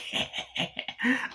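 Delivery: imitated mocking cackle; villain's laugh. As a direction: snicker like a killer doll. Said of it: "Chucky starts laughing".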